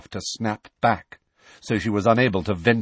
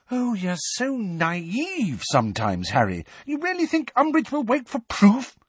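scornful Hermione; 12 semitones higher than usual. A narrator's reading. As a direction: scold him for being naive. Hermione in temper.

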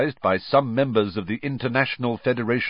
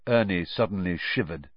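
plain reading voice

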